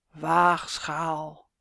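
low, drawn-out voice from the grave; sepulchral voice. A narrator's reading. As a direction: intone it ominously, down in the tomb. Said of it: "met een grafstem".

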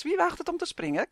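high cackling witch's taunt. A narrator's reading. Witch dares the elves to jump, cackling. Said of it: "kakelde ze".

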